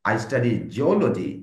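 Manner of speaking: very slow, clear model sentence with the stress on geology. Shown as a demonstration. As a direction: spell it out slowly for the class and lean on geology. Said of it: "এভাবে আপনাকে বল-, বলতে হবে".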